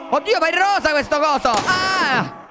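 high, startled exclamation; sudden high surprise; surprised squeal. Real, not acted. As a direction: blurt it out in shock at the pink thing. Shocked by pink zombie.

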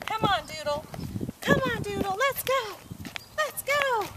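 bright high call to the dog. Real, not acted. Trainer coaxing her dog to come along, high.

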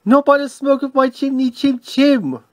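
high-pitched storybook refusal; frightened defiance. A narrator's reading. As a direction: chant it high like a scared little pig. Toby answers ghost like little pig.

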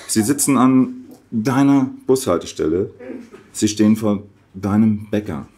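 measured recital with deliberate pauses between phrases; poem reading. Acted. Reading a satirical poem about Adiletten to the audience, deliberate poetry recital. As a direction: recite it line by line, letting each phrase land.

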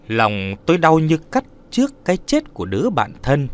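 ordinary delivery

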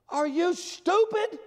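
high shouted outburst at another driver; retold yell. Yelling at the driver who cut him off, high shout. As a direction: yell it at the top of your lungs. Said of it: "I yelled at the top of my lungs".

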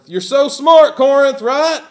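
high, loud mock praise; preacher mocking Corinth's pride with loud sarcasm; sarcastic. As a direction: taunt them with fake admiration.

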